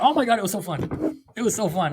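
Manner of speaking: high, bubbly imitation of a thrilled newcomer; playfully imitating an overjoyed first-timer; gushing newbie. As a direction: mimic someone gushing that it was so fun. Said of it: "they always come back to, like".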